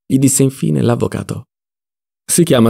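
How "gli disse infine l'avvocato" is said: usual speaking voice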